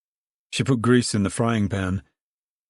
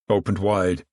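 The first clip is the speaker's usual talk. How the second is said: plain reading voice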